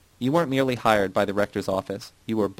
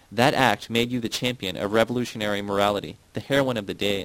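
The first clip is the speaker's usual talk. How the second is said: ordinary delivery, nothing marked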